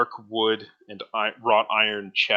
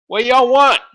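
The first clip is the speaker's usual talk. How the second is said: high, loud dwarf call; dwarf leaning out a window calling back; gruff dwarf calling; 16 dB louder than usual. Acted. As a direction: holler back from the carriage window.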